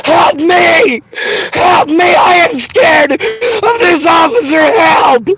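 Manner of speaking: frantic high shouts for help; screaming for help. Real, not acted. Woman screaming for help at officers. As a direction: yell for help at the police.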